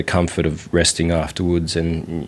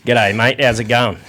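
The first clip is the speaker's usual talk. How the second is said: Aussie accent; 3 semitones higher than usual. Shown as a demonstration. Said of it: "that's Aussie, mate".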